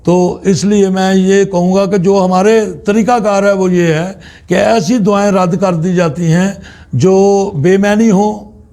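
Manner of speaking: normal talking voice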